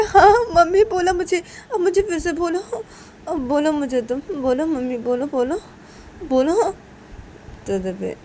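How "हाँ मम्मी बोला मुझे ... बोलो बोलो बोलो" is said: put-on high, loud, laughing while urging the baby; a delighted mother begging her baby to say mummy again; giggling, eager coaxing.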